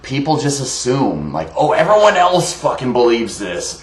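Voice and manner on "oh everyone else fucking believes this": higher, mocking quote of the gullible crowd; mock know-it-all. Ranter mimicking people who just assume, mocking. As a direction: parody someone parroting the common belief.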